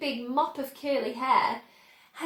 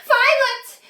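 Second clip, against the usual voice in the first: high, loud shrieked name; scream; 11 semitones higher, 11 dB louder than usual. A narrator's reading. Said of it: "screamed Missus Beauregard".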